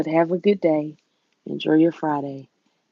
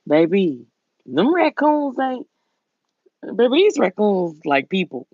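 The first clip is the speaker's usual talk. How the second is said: southern drawl; 5 semitones higher, 3 dB louder than usual. Retold or imitated. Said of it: "I'm from the South".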